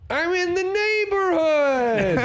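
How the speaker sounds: very high, stretched-out comic caller voice; Andy Dick. Retold or imitated. Andy telling Jim he is nearby, high drawn-out impression. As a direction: drag every word out way up high. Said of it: "Not, not a bad impression".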